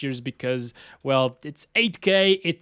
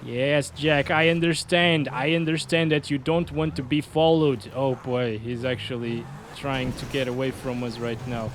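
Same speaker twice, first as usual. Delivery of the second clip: ordinary talk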